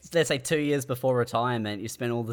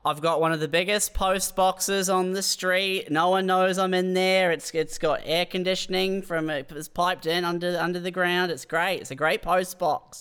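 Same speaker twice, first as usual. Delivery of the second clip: lightly raised, boastful voice; mock proud resident; 6 semitones higher than usual. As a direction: brag about the post box like a mansion. Bit, proud homeless post box dweller.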